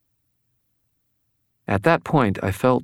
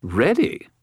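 much higher disbelieving spirit; incredulous skull voice; 8 semitones higher than usual. A narrator's reading. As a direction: scoff at the word like a skull. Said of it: "Bob said".

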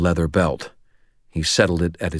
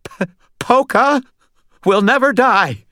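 high, halting, nervous stammer; scared, high-pitched stutter; 5 dB louder than usual. A narrator's reading. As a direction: stumble on the first sound, then push it out. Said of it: "he stammered".

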